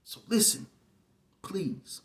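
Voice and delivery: normal talking voice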